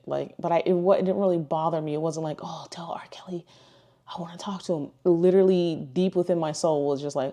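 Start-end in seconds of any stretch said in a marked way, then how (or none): 2.4-4.9 hushed, half-whispered pretend fan; mock eagerness. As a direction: softly fake being star-struck. Mocks eagerness she did not feel.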